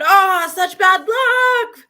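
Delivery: high, loud, slow pitying neighbor; overdone neighbor lamenting bad luck. Neighbor voiced in story.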